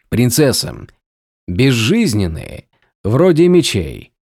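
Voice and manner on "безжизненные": usual speaking voice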